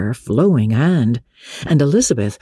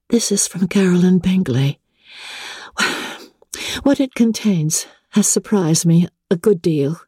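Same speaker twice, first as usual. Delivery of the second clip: Jane reading the news out, lightly unsettled; Jane, gently surprised; 6 semitones higher than usual. A narrator's reading. Jane tells Elizabeth the Bingleys have left, mildly dismayed. Jane reads Caroline's letter.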